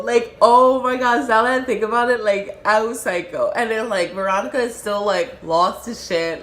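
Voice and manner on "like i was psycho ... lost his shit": usual speaking voice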